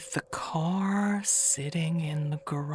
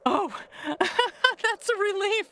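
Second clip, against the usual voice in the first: nervous laugh; 13 semitones higher than usual. Acted. Agnes laughs nervously mid-line.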